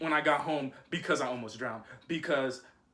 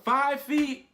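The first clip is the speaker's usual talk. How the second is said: cocky inner voice; 11 semitones higher, 3 dB louder, slower than usual. Retold or imitated. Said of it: "so I'm like".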